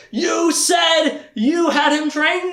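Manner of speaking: slow, high, loud accusing shout; mock angry shout. Darken Rahl yelling at Denna, a hammed-up high shout. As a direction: bellow it like a furious overlord.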